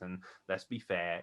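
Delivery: ordinary talk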